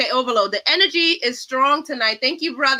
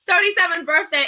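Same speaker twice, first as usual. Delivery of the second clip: excited repeat; 8 semitones higher than usual. Real, not acted. Host to panel after laughing, gleeful repeat. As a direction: bounce back onto the number with glee.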